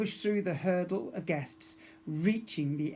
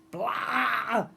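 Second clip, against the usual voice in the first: loud blah; 7 semitones higher, 5 dB louder than usual. Acted. Magic moment exclamation.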